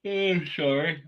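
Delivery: mid-yawn, stretched words. Real, not acted. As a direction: talk through a yawn. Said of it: "I'm yawning".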